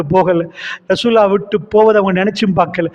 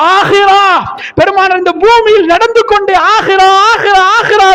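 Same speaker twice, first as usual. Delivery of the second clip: much higher and louder, exultant calls; the Prophet exclaims about the hereafter, much higher and louder; ecstatic cry; 11 semitones higher, 5 dB louder than usual. Acted. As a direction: cry out the word ஆஹிரா like a rapturous proclamation.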